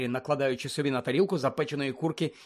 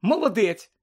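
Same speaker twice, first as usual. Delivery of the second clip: high-pitched, pleased astonishment; bright lift of surprise, growing louder; amazed; 7 semitones higher, 4 dB louder, slower than usual. A narrator's reading. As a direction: praise in sudden amazement. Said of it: "вразилася Герміона".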